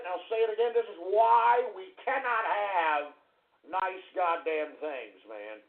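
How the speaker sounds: ordinary delivery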